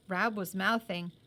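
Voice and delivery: ordinary delivery, nothing marked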